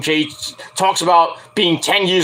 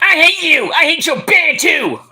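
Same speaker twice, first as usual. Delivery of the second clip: mocking angry pop star; 10 semitones higher than usual. A mocked [name] shouting at her husband. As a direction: shriek it like a spoiled singer. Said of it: "She probably was like".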